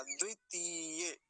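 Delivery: द्वितीय slow and soft, ती stretched; short द्वि, long held ती in द्वितीय. Shown as a demonstration. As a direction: draw out the ती in द्वितीय. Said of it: "तो लंबा खींचेंगे थोड़ा सा".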